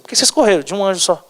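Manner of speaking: quick, mildly indignant telling-off; light mock reprimand to underlings; mild scolding. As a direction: scold them without really shouting. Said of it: "ele deu uma bronca nos anjos dele".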